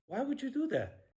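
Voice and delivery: high, loud indignant question; indignant disbelief. Real, not acted. Objecting to Shirley busting his balls, indignant. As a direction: demand an answer, voice shooting up.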